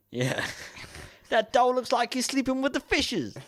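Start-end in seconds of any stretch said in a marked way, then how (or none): 1.3-3.4 hammed-up gangster accent; mafia American accent. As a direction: play a Hollywood mafia heavy. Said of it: "That's a mafia film now".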